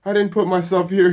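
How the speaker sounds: loud, high-pitched denial; shrieking protest. A narrator's reading. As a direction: shriek it in disbelief. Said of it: "he shrieks".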